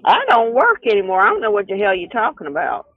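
ordinary talk

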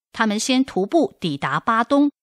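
ordinary delivery

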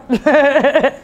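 loud, high laugh; joking laugh. Acted. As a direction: laugh out loud at your own joke. Joking about selling a car.

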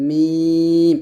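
মিম with a long held vowel, closing on a hummed m; lips-together m. Shown as a demonstration. Teacher shows pupils মিম, held long. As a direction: draw মিম out and shut the lips on it. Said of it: "এভাবে".

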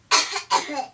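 a short, dry hacking cough; raspy cough. Acted. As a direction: cough like smoke caught in the throat. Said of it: "please pardon my cough".

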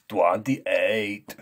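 forceful strongman quiz answer. Pretend. Strongman takes the review quiz, answering forcefully. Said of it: "Take it away".

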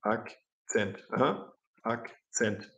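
Akzent said the Swiss German way; a light Swiss German accent on Akzent, slow on the last one. Shown as a demonstration. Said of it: "in der Schweiz ... sprechen die Leute Akzent".

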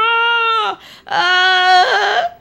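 high, long toy scream; high-pitched mock shriek; pretend terrified scream. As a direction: scream as if chased by a monster. Figures scream at monster.

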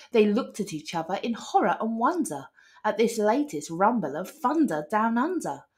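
ordinary delivery

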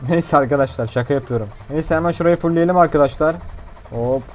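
usual speaking voice

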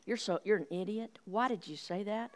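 hushed self-berating, acted out; negative self-talk. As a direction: scold yourself under your breath. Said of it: "my talk was negative".

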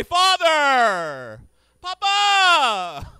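high, loud cry of Papa; mock wail. Son calling out for his father, hammed-up wail. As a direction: call out like a grieving son, hammed-up.

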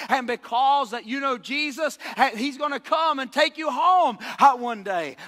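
preacher climbing to a roar; full-force preaching. Acted. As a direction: build it up and shout the verse.

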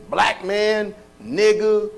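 ordinary talk, nothing marked

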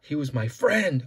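ordinary delivery, nothing marked

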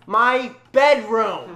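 slow, loud, high outburst; angry, drawn-out announcement. Acted. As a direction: draw it out loudly and angrily. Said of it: "You're an angry".

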